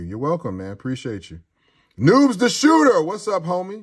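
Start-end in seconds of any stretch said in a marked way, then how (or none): 2.0-3.8 hyped shout-out to a viewer; excited shout-out. Real, not acted. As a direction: call out the name like a hype host. Greeting a chat donor.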